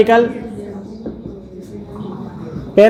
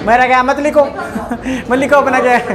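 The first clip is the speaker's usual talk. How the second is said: chuckling through the words; laughing; 2 semitones higher than usual. Real, not acted. Teacher laughing off skipping a theory, chuckling. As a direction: laugh it off lightly.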